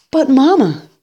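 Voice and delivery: high, loud protesting cry; cried out. A narrator's reading. Boy crying out to Mama against shooting the dog, high and loud. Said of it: "I cried out".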